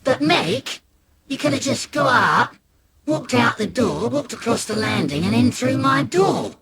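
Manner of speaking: put-on high, reasoning kid voice; smug little brother. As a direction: point out the obvious. Brother mocks the plan.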